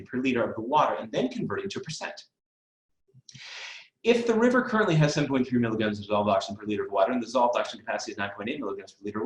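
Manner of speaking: word problem rattled off fast; rapid read-aloud. Shown as a demonstration. Tutor models an overwhelming math problem for students, read fast. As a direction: race through the wordy problem without letup. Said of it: "read it as fast as I did that".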